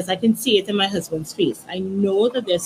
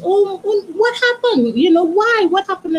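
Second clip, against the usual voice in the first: much higher, flustered gasping questions; shocked dismay; 10 semitones higher than usual. Retold or imitated. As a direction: caught off guard, pitch flying up in alarm. Shocked acquaintance asks what happened.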